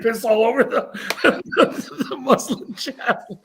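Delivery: telling it through real laughter; high, helpless laughing delivery; cracking up. Real, not acted. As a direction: burst into laughter mid-sentence. Teller cracks up at punchline.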